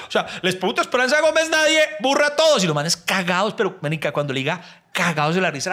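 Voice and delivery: ordinary talk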